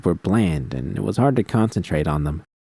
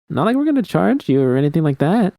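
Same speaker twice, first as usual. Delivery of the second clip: lightly voiced, higher girl pressing; pushy party girl; 9 semitones higher than usual. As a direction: push the offer, a bit higher. Other girl pushes the offer.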